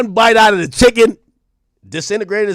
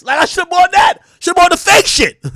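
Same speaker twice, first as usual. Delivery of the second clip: frustrated shout; 9 semitones higher than usual. Real, not acted. Venting to chat about overpriced merch, exasperated shout. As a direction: blurt it out like you got robbed. Regret over buying legit merch.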